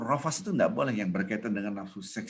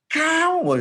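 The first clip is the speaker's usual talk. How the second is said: high, loud sudden "Kau!"; explosive high-pitched holler; mock angry yell; 9 dB louder than usual. Shown as a demonstration. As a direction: shout it as a demonstration of raised voice. Demo of forbidden high, loud talk.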